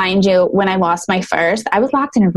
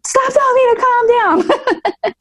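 very high, slow, laughing exasperated protest; half-laughing, half-furious, stretching it out; mother in labour snapping back at the nurse, high and laughing; exasperated outburst; slower than usual. Retold or imitated. Panicking patient snaps at nurse.

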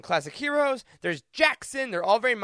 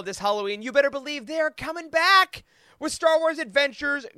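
ordinary delivery

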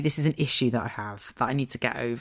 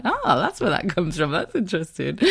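mock realisation; 3 semitones higher than usual. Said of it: "I'll be like".

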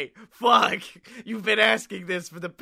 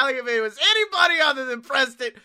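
normal talking voice

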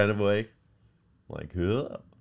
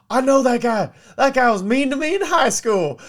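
much higher and louder, an outraged squeal; indignant outburst; 10 dB louder than usual. Retold or imitated. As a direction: shriek it like you just spotted your old bully. Repo man recognizes old bully.

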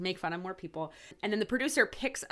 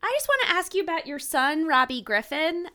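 higher, lightly voiced boy on the phone; young boy caller; 8 semitones higher, 3 dB louder than usual. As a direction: play a kid caller, pitched up. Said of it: "a young sounding boy".

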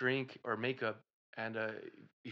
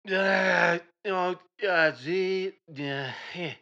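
caveman gibberish; 6 semitones higher, 8 dB louder, much slower than usual. Acted. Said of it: "trying not to use an accent".

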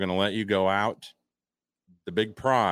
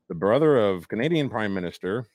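normal talking voice

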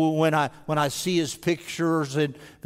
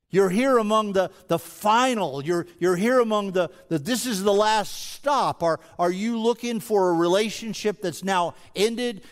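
impassioned preaching; 5 semitones higher than usual. Acted. Preacher pressing the congregation with urgent questions. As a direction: press each question home from the pulpit.

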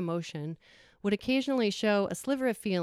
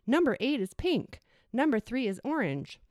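put-on excited child; 6 semitones higher than usual. Child calling out horse colors.

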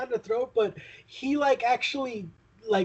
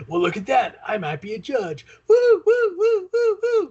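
loud, frantic whooping cartoon voice; Daffy-style manic outburst; 8 semitones higher, 6 dB louder than usual. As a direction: go full cartoon, hooting wildly. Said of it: "expect him to go like".

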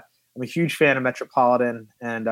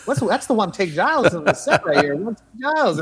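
high, fast teasing call to the actor across the set; hammed-up ribbing, calling him over; mock crew teasing; 8 semitones higher, faster than usual. Film crew ribbing the lead actor about the cat, high teasing call. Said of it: "And so we would be like".

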